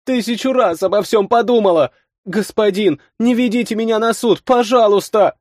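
put-on high, pleading girl voice; pleading young girl. A narrator's reading. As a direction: beg like a scared young girl.

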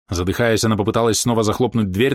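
ordinary delivery

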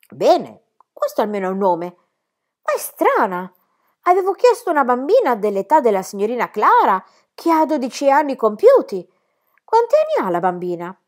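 high, loud, indignant governess voice; a strict lady scandalised by the name; stern governess. Acted. Rottenmeier interrogates the child about her name, high and indignant.

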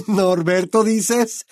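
high, slow, amused disbelief; laughing. A narrator's reading. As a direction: laugh through the words. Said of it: "muerto de risa".

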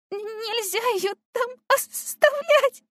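usual speaking voice

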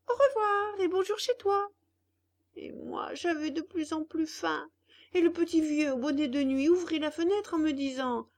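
a hungry puppet complaining to his father; puppet boy voice. Acted. Pinocchio recounts his misadventures.

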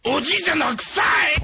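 high, loud brat shouting; bratty kid yell. Retold or imitated. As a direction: yell it like a rude little kid. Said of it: "クソガキがさ".

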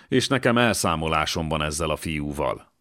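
plain reading voice

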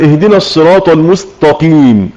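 الصراط المستقيم with emphatic ṭa; mistaken emphatic ṭa. Shown as a demonstration. Teacher demonstrating the ta-to-ṭa error to students, mispronounced. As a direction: say المستقيم with a heavy ṭa, a wrong reading. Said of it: "بدل التاء بالطاء".

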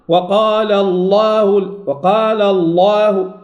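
slow «وقال الله» with heavy L but thin long A; erro de recitação. Shown as a demonstration. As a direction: recite الله wrongly, dark L sliding to fronted vowel. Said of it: "يفخم اللام ويرقق الألف".

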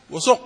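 "وسق" said with a rounded u after the seen, "wa-suq"; damma on the seen. Shown as a demonstration. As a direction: give the seen in "وسق" a u vowel. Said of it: "وضع ضمة". (نطق "وسق" بضم السين)

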